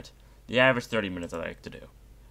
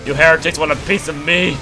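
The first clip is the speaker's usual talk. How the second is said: loud, higher space marine war cry; battle taunt; 8 semitones higher, 11 dB louder than usual. Pretend. Gamer voicing his soldier taunting the heretic enemies, loud war cry. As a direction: roar a challenge at the heretics.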